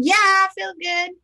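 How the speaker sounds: high, exuberant exclamation; really feeling good. Shown as a demonstration. Coach showing someone walking around feeling great, exuberant. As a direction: belt it out with wholehearted pep. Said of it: "feeling really good and I'm really sincere".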